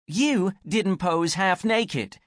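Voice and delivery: high, slow, desperate complaint; pleading, voice climbing; rising desperation. Acted. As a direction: Anne complaining to Rebecca, desperate. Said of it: "her voice was rising again as desperation returned".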